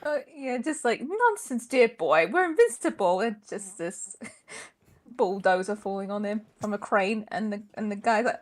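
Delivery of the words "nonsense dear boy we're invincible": mock British gent. Playing an unflappable British gent in skit.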